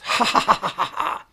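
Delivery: breathy imitated villain cackle; mock cackle. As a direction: cackle like a mad witch. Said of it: "She's cackling".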